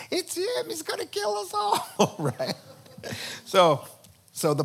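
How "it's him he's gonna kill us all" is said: much higher, half-laughing panic; frightened accusation. Retold or imitated. Queen imagining accusing Haman, high mock panic. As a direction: blurt it out in mock terror. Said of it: "she's gonna have to say".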